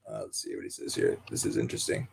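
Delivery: whispered to himself while skimming the article; a voiceless mutter, reading along; muttered aside. Real, not acted.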